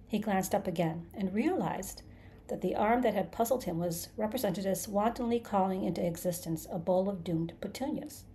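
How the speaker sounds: ordinary delivery, nothing marked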